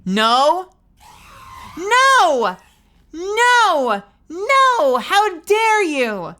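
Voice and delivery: high, loud, slow outrage on dare; hammed-up outraged shout. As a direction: bellow in mock indignation. Yelling again at the pet.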